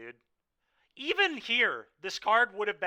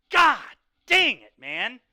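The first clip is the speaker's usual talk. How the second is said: frustrated outburst; 7 semitones higher, 13 dB louder than usual. Real, not acted. Frustrated after losing the game.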